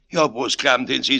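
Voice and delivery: loud, high Bavarian retort; ungracious scolding. A narrator's reading. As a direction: snap back indignantly. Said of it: "er war einmal im Schimpfen drin". (ungnädiges Schimpfen)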